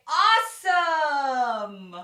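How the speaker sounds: loud, slow stretched Awesome then a high Yay; big cheering finale; excited cheer. Shown as a demonstration. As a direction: celebrate with arms up. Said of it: "throw your arms in the air and say".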